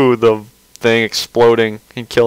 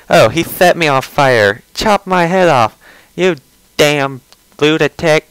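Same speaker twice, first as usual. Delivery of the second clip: higher, put-on whiny voice, slowing at the end; mock his whiny rant; hammed-up imitation of a whiny creator; Chris Chan impression; 4 semitones higher than usual. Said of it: "That's pretty much his voice".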